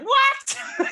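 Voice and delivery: sudden high, loud disbelieving yelp; shocked what. Retold or imitated. Yelping at the team leader's news, stunned. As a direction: squeal it in total disbelief. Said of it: "I was like".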